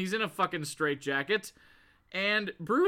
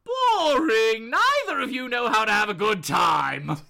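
Joker; 3 semitones higher, 7 dB louder than usual. Acted. Villain mocking the two who spare him, loud jeer. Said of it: "and the Joker goes".

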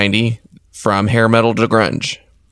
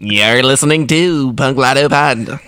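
radio announcer voice; 4 semitones higher than usual. Pretend. Mock radio announcer intro.

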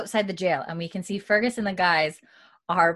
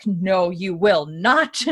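slow and louder, every word weighted; firm refusal; 6 dB louder, much slower than usual. Retold or imitated. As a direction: put your foot down, slowly. John firmly refuses Bree's request.

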